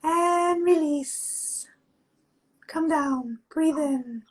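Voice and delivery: slow, calm instructor voice; guided exercise. Acted. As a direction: guide the class gently, drawing words out. Leads a face yoga exercise.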